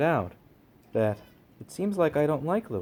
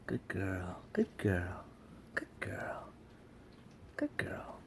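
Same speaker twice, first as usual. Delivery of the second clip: slow, soothing whisper; hushed, unvoiced reassurance; whispered praise; 8 semitones lower, 13 dB quieter, slower than usual. Real, not acted. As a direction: whisper fondly to a nervous pet. Soothing the cat while petting.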